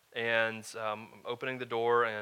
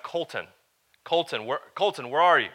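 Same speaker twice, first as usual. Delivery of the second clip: put-on raised calling for a lost child, building louder; a father's anxious call, getting louder; 4 semitones higher, 8 dB louder than usual. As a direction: call out his name across the lot. Parent calling for lost son.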